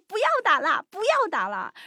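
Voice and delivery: high mock pleading voice; imitated girlfriend. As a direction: act out a girlfriend begging them to stop. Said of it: "听见他的女朋友在后面说".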